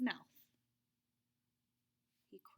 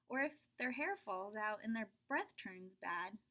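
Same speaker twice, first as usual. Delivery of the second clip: plain reading voice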